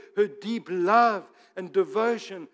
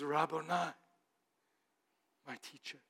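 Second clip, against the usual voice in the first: much softer, fading to near silence; awed, hushed recognition; hushed awe; 3 semitones lower, 9 dB quieter, faster than usual. Acted. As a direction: breathe it out in wonder. Mary recognizing Jesus at his feet.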